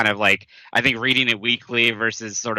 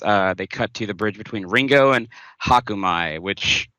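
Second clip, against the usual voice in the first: ordinary delivery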